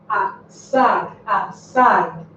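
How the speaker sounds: "aside" with "a" set apart and stress on "side"; stress on last syllable. Shown as a demonstration. Teacher repeating the example for students, last-syllable stress on "aside". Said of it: "the stress should be on this last".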